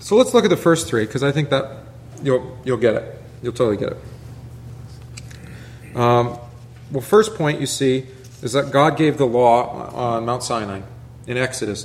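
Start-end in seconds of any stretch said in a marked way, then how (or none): 2.2-6.0 whispered aside. Real, not acted. Soft aside to the congregation.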